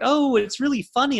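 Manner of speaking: high, lilting mock reaction; amused friend. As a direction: pitch it way up as a quoted friend. Voicing friend's reaction to a film.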